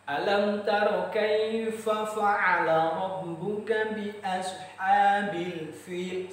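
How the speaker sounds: slow, melodic recitation with each letter distinct; correct tajwid recitation. Shown as a demonstration. Teacher showing how Al-Fil should be recited, slow melodic chant. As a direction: recite carefully, letting each letter sound.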